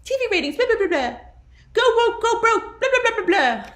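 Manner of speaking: high, loud jeering imitation; mocking critics. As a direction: parrot the naysayers in a high silly voice. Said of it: "These same people who are like".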